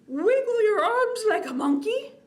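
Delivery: high, loud, bouncy prompt; pep it up like a silly game; playful. Acted. Librarian getting kids to wiggle like monkeys, high playful call.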